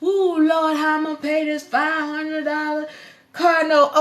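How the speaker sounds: high, fretful "how will I pay" whine; worried debtor voice. Retold or imitated. Viewer mocking a worried debtor to the host. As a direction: act out the panicked person with the bill. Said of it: "You like".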